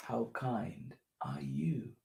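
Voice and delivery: slow, hushed whisper. Pretend. Host whispering the kindness question to children, slow. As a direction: whisper it gently and slowly. Same question, repeated in turns.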